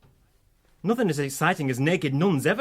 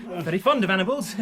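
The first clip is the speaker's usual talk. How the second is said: usual speaking voice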